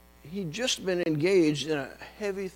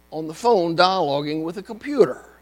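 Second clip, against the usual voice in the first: normal talking voice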